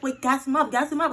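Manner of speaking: excited urging. Retold or imitated. Urging, louder.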